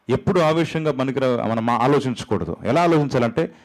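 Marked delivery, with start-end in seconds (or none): none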